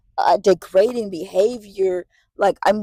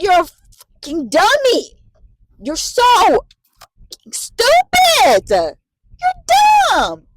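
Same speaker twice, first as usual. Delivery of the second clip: slow, drawn-out, higher insult; exasperated scolding; 12 semitones higher, 4 dB louder, much slower than usual. Retold or imitated. Caretaker scolding the stubborn patient, slow exasperated insults. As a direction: stretch every insult out in disbelief. Scolding Leslie for being stupid.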